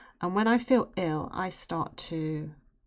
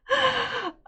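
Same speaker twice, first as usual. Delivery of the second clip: big laugh; 4 dB louder than usual. Real, not acted. Laughing at shared story.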